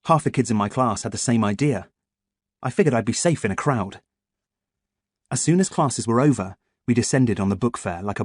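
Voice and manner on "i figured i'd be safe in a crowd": ordinary delivery, nothing marked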